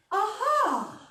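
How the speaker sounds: high, loud exclaimed Aha; lifted Aha. Shown as a demonstration. Coach demonstrating exercise to lift the voice, high Aha. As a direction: lift it up high off the vocal cords. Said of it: "we're just gonna go".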